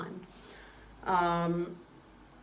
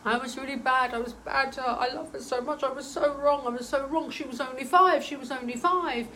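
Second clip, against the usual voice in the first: raised, pleading father's voice; remorseful father; 5 semitones higher, 4 dB louder than usual. Acted. Dead father confessing to his daughter, remorseful and raised. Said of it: "It was like he was talking through me".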